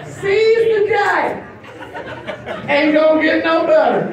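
usual speaking voice

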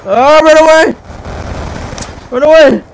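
screaming run away in panic; high, loud panicked shout; panicked yell. Real, not acted. Fleeing enemy in panic.

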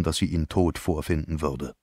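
ordinary delivery, nothing marked

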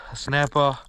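normal talking voice

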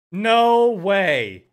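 loud stunned cry; real loud shock; shocked outburst. Real, not acted. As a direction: blurt it out in disbelief. Shocked at a case drop.

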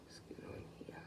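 hushed private whisper. Real, not acted. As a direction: breathe it under your breath. Peering at egg quietly.

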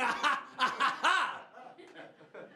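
loud, high preacher's "ha"; hammed-up pulpit whoop; preacher's rhythmic cry. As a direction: punch out drawn-out gospel exclamations. Preacher's cry after calling for singing.

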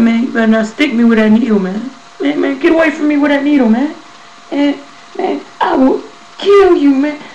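high, fast, loud yelled threats; panicked threats. Retold or imitated. To the doctor with the needle, frantic yelled threats. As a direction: scream threats to keep the needle away.

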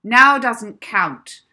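loud, slow, cutting put-down; slow loud mistress overruling the excuse; stern teacher. Acted. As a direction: drag it out, loud and final. Teacher rebuffs late girl.

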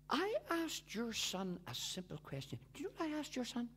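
hushed confiding whisper; teacher whispering to the mother. Retold or imitated. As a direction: lean in and whisper it.

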